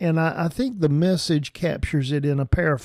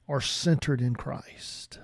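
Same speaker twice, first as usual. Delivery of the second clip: hushed whisper; 2 semitones lower, 7 dB quieter than usual. Real, not acted. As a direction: whisper it, softer, slower. Sermon's intimate key point.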